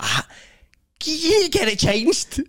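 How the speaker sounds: high, slow, pleading whine; mock high whining request; whiny colleague. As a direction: beg like a fussy guest. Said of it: "And he's like".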